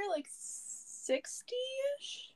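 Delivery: incredulous. Real, not acted. Mocking the cold complaint.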